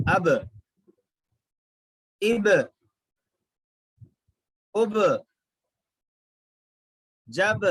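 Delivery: echoing bounce on each final b and j; qalqala drill. Shown as a demonstration. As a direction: knock each final consonant and release. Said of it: "इस तरीके से पढ़ेंगे इसको".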